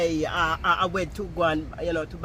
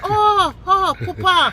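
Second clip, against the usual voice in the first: loud, much higher "Oh, oh, pupa"; excited realisation; 16 dB louder, much slower than usual. Retold or imitated. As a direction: vendor suddenly understanding, yelled with delight. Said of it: "The man said".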